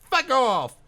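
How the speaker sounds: high, loud heckle; angry shout. Acted. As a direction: yell it at the speaker. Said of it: "another voice shouts".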